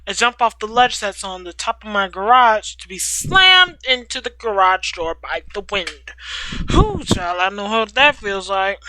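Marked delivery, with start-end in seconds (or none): none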